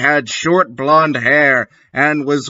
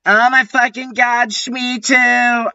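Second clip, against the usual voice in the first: high, quick fangirl shriek; 11 semitones higher than usual. A narrator's reading. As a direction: shriek it in hammed-up delight. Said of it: "I shrieked".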